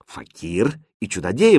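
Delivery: usual speaking voice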